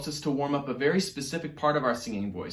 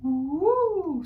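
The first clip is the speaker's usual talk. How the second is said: much higher, soft head-voice woo; a gentle high woo; soft Baby Owl woo; 3 dB louder than usual. Shown as a demonstration. Teacher shows the soft Baby Owl voice, soft high woo. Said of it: "using that same soft voice".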